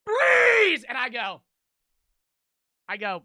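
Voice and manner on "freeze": high, very loud yell; screamed command. Retold or imitated. Man stepping out at walkers, screamed order. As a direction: shout it to stop someone dead. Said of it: "and screams".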